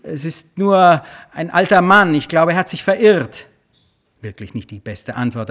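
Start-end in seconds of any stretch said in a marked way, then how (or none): 0.0-3.5 high, loud, nervous call across the yard; man with raised hands calling out to the gunman. A narrator's reading. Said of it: "und rief".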